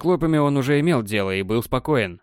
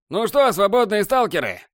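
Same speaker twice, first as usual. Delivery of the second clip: высокий театральный зловещий голос; нагнетай угрозу, как злодей; зловеще; 8 semitones higher than usual. Acted. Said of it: "зловеще произнёс новый комментатор". (зловещий голос злодея)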